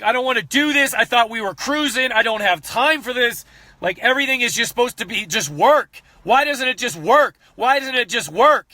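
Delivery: high, exasperated whining rant; frustrated rant. Retold or imitated. Frustrated rant over broken videos, high whining. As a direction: plead with the universe, fed up. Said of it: "out of rage and frustration".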